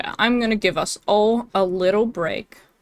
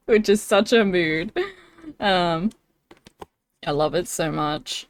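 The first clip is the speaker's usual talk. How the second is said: ordinary delivery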